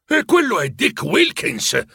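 high, slow, delighted cry; excited cry. A narrator's reading. Scrooge spotting his old fellow apprentice, excited cry. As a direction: cry out in recognition. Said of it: "gridò Scrooge".

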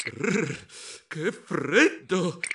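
slow, drawn-out shiver; teeth-chattering "rrr" into a slow complaint; shivering murmur. Acted. Mouse nearing the fire to warm up, shivering murmur. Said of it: "mormorai avvicinandomi al fuoco".

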